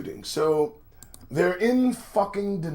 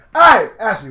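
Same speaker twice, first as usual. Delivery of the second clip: louder hail to stop her; calling out; 4 semitones higher, 10 dB louder than usual. Pretend. Friend calling Ashley back, louder. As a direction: call her back before she goes.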